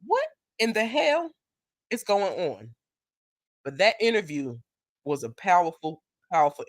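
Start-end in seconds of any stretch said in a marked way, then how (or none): 0.1-2.8 mock shock. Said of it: "But I'm like".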